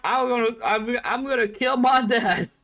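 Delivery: a stubborn son lashing out at the father figure; defiant outburst. Retold or imitated. Rebellious son defying the Emperor.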